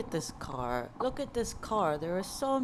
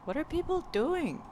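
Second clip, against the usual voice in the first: much higher, swooping pitch; incredulous exasperation; 11 semitones higher, 3 dB louder than usual. Real, not acted. Cyclist fed up with early Sunday traffic, incredulous high-pitched exasperation. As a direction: repeat it in high-pitched disbelief. Repeats question, more exasperated.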